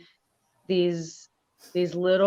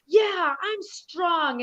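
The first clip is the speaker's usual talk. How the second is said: put-on much higher, slow little-girl voice; toddler voice; 11 semitones higher, much slower than usual. Niece proudly answering her auntie, high little-kid voice. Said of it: "And she's like".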